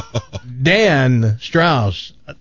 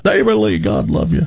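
folksy Southern greeting, pitched up; lightly voiced country good old boy; 5 semitones higher than usual. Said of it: "perfect good old boy country voice".

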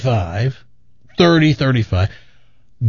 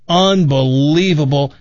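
ordinary talk

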